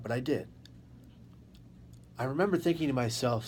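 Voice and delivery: ordinary talk, nothing marked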